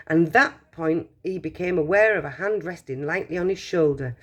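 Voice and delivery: ordinary delivery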